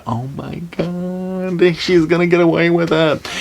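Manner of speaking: high, slow, horrified disbelief; stretched-out horrified reaction; mock horrified gasp. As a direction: gasp it out like a shocked viewer. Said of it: "you're just like".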